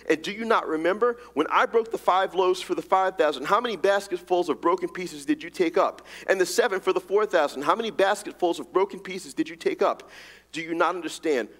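quick, pressing questions, one after another; rapid fire questions. Acted. Preacher voicing Jesus grilling his disciples, rapid fire. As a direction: fire them off to snap them out of it. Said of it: "he has these rapid fire questions".

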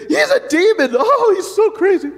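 high, loud mocking voice; mocking critics. Critics mocking John, high, loud and laughing. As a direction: sneer it out with a laugh. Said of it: "they look at him and say".

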